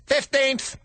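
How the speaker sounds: high, quick hare chirp; squeaky hare; March Hare voice. Acted.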